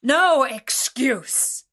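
angry refusal. A narrator's reading. Beast answers the merchant's question, booming angry refusal. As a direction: thunder it out word by word.

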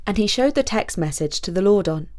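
plain reading voice

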